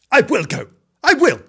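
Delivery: high, loud, determined little kitten; defiant kitten. Acted. Kitten vowing to himself he will go, defiant. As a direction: insist like a plucky kitten.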